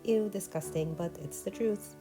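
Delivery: ordinary talk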